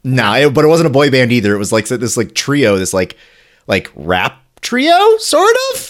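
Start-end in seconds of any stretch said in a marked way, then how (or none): 3.7-5.9 slow, rising high on sort of, like a question; questioning uptalk. Shown as a demonstration. Explaining a music group to her co-host, questioning uptalk. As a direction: let it climb up as if asking. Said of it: "Question mark?".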